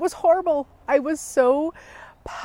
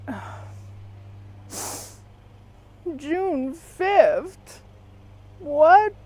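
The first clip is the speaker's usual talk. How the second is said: shocked outburst; 7 semitones higher, much slower than usual. Retold or imitated. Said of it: "maybe I didn't sound like that".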